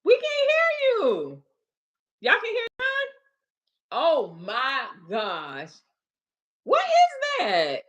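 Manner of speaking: high, slow, stretched exasperation; host stunned that the guest's audio still fails, baffled; baffled disbelief. Real, not acted. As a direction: drag it out, can't believe it.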